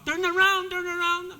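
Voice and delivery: high, loud quoted cry; retold cry. Quoting the cry from the song, high and loud. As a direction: call it like in the film. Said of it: "ang sigaw".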